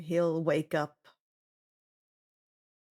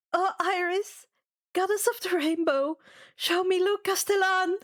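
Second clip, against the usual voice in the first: insistent plea; 8 semitones higher than usual. Acted. Second try, more insistent.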